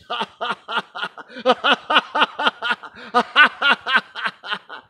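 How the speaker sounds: long high, loud laughing fit; hearty laughter demonstrated in full; loud demo laugh. Shown as a demonstration. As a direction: show how he burst out laughing. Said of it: "He just started laughing like this".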